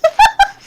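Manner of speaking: sudden squeaky laugh; high burst of laughter. Real, not acted. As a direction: let out a high cackle. Laughs at sloth joke.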